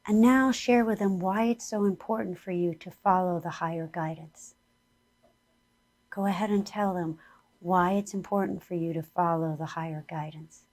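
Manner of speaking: guided meditation. Acted. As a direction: lead the listeners gently inward.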